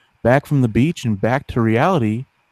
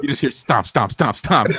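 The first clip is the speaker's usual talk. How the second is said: words through laughter; laughing; 5 semitones higher than usual. Real, not acted. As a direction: giggle through the stomps. Laughing while describing stomps.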